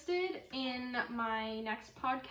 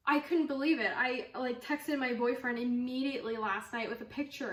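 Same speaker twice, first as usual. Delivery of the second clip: ordinary talk, nothing marked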